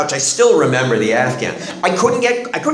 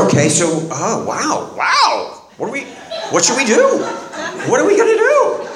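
laughing disbelief; 9 semitones higher than usual. Retold or imitated. Retold reaction to wife's news.

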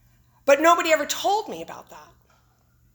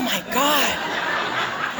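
ordinary talk

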